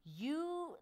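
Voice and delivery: a hint of an exasperated husband; annoyed husband. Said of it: "he'll be like".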